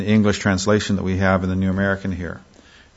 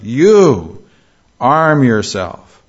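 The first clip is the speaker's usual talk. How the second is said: "You" loud and drawn out, then a pause; stress on YOU; 2 semitones higher, 4 dB louder, much slower than usual. Shown as a demonstration. Preacher showing the emphasis on the subject, stress on YOU. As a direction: hit "You" hard, then slowly "arm yourself". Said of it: "this puts an emphasis on the subject".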